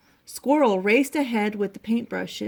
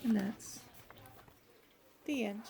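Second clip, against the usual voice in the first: very soft storybook close; hushed ending; 13 dB quieter than usual. A narrator's reading. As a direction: let the ending fade almost to a whisper. Closing the bedtime story softly.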